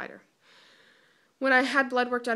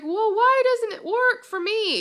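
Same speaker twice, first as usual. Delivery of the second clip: much higher and louder, drawn out in frustration; frustrated outburst; 12 semitones higher, 12 dB louder than usual. Retold or imitated. Retelling her reply to the doctor, a loud, high, frustrated plea. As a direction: cry out why it won't work, slowly.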